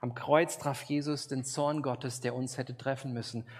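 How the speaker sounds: ordinary talk, nothing marked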